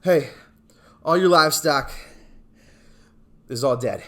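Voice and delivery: loud news broken up by long pauses; breathless servant. Acted. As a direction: gasp out the bad news in bursts. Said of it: "breathing heavily. He's panting".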